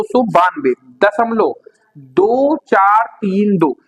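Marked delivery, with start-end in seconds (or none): 2.0-3.8 digit by digit. Shown as a demonstration. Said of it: "यानी आपको ऐसे नहीं पढ़ना है".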